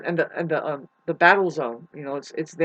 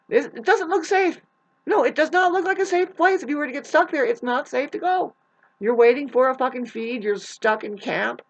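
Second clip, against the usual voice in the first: indignant rant; 6 semitones higher than usual. Real, not acted. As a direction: insist it isn't safe, worked up. Heated rant about unsafe campuses.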